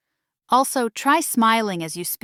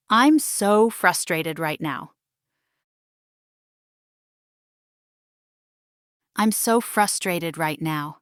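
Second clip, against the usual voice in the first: calm frustration; 4 semitones lower than usual. Shown as a demonstration. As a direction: vent calmly, without shouting. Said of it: "express anger or frustration, but calmly".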